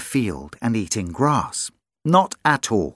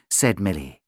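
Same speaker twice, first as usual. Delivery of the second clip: ordinary delivery, nothing marked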